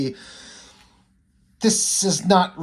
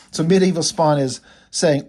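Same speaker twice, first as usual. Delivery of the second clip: normal talking voice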